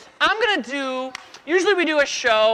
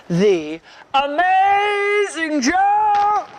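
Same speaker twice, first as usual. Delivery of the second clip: slow, drawn-out announcer boast; grand showman; 8 semitones higher, 4 dB louder, much slower than usual. Acted. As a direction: announce yourself like a circus ringmaster. Grand showman self-introduction.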